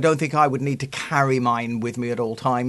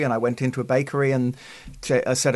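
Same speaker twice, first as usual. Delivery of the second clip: ordinary delivery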